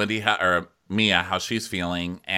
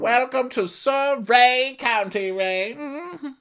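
high, slow cooing voice; mock gushing welcome; slower than usual. Co-host echoing the welcome to Ray, mock rapturous. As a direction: echo the overdone greeting in falsetto.

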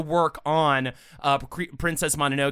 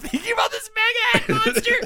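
high, squeaky talk through laughter; cracking up; faster than usual. Real, not acted. Co-host recalling the monster joke, real helpless laughing. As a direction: struggle to speak while giggling helplessly.

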